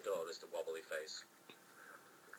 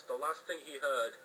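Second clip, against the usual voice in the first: ordinary delivery, nothing marked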